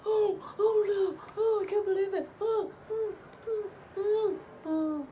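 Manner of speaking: mock sobbing guy; mock dismay. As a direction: ham up his fake shock. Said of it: "and Blake is like".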